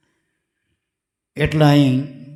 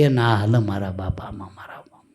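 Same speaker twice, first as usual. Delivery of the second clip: whispered aside; 9 semitones lower, 6 dB quieter than usual. Real, not acted. Preacher confiding to listeners that such self-made gurus don't count, whispered. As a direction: drop to a breathy whisper.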